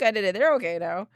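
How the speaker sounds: ordinary delivery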